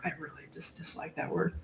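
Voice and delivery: very soft, near-whispered aside; under the breath, barely voiced; muttered aside. Real, not acted. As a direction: drop to a whisper, as if to yourself. Muttered aside on a disliked word.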